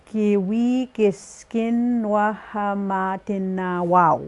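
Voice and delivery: slow deliberate phrase, word by word; carefully pace out each syllable; slow teaching model of the phrase. Shown as a demonstration. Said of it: "syllable by syllable".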